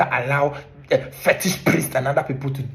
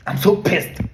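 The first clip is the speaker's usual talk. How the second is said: louder, raised breathy outburst; furious hiss; 6 semitones higher, 4 dB louder, slower than usual. Real, not acted. Ranting vlogger erupts at the politicians, furious hiss. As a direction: spit it out, boiling over.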